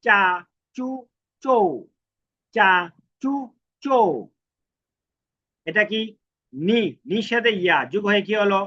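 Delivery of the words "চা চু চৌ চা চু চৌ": চা চু চৌ stretched out very slowly, each syllable held long; teacher sounding out চা চু চৌ syllable by syllable; slow pronunciation demo. Shown as a demonstration. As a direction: drag out চা, চু, চৌ so learners hear every vowel. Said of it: "তাহলে উচ্চারণ কেমনে হবে?".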